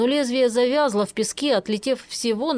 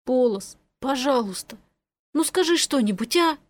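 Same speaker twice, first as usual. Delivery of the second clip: higher-pitched, tender begging; mildly distressed plea; anxious pleading; 3 semitones higher than usual. A narrator's reading. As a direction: coax an unresponsive friend to answer. Boy begs lifeless friend to speak.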